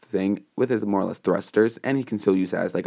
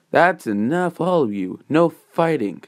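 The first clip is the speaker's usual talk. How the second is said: higher-pitched call to order; lightly voiced announcer; 7 semitones higher, 3 dB louder, slower than usual. Teacher to students about to fight, raised commanding call. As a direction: break up the squabble with lifted voice. Press Mike breaking up a fight.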